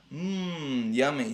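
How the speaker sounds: drawn-out dreamy "mmm"; mumbled. A narrator's reading. Fox dreaming of food under gas, mumbling with relish. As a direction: savor it lazily, as if half asleep. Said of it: "he mumbled".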